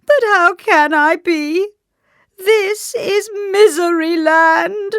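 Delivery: very high, loud, drawn-out despair; theatrical sobbing lament; wailing king. A narrator's reading. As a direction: wail helplessly at full pitch. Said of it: "cried the king".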